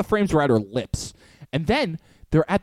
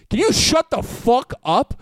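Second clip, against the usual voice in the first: slow, higher-pitched mock telling-off; dramatic outburst; 4 semitones higher than usual. Student silencing chatterers mid-lecture, dramatic outburst. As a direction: spin round and tell them off. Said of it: "Dramatic turn. He goes".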